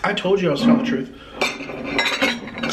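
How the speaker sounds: usual speaking voice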